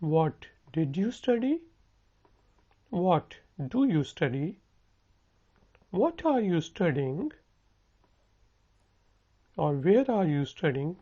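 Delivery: very slow, each word spelled out for learners; slow it right down so students can copy; slow model sentence. Shown as a demonstration. Teacher slowly modelling a question for students to repeat. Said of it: "मेरे साथ repeat करें".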